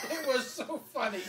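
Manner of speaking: high, loud words through laughter; breathless amusement; real laughter. Real, not acted. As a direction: say it while cracking up. Laughing at own story.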